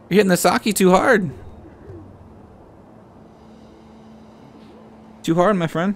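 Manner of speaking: put-on playful high scold to a drunk guard; mock scolding. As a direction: wag a finger at the drunk.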